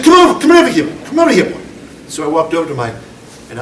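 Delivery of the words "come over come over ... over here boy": put-on high, loud summoning call to a child; Irish grandmother calling. Irish grandmother summoning her misbehaving little grandson, high and loud. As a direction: call the boy over sharply, voice pitched high. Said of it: "And she said".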